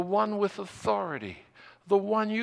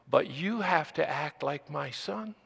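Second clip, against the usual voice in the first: usual speaking voice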